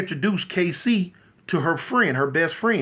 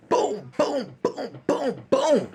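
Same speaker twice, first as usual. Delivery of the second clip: higher-pitched booms in a quick volley; imitated gunshots; 5 semitones higher, 3 dB quieter, much slower than usual. Storyteller imitating KC's gunshots, punchy mimicked booms. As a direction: mimic gunfire with your voice.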